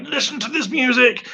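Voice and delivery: high-pitched gushing, playfully re-enacted; mock excited yell. Excited fan ringing a friend about a song, high mock yell.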